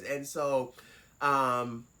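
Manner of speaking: usual speaking voice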